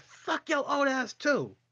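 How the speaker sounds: high, slow mocking retort; mock parting shot. Worker mocking the rude customer leaving, high and slow. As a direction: sneer it high and drawn out at the old man.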